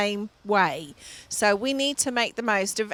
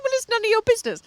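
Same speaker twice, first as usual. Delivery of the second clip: much higher, indignant snap at the cashier; nuclear outburst; 12 semitones higher, 3 dB louder, much faster than usual. Retold or imitated. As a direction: cut her off in outrage. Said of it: "I went nuclear in about four seconds".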